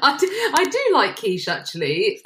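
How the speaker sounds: high-pitched reply with a laugh; amused. Real, not acted. Co-host answering whether they like quiche, amused. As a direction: laugh lightly through the words.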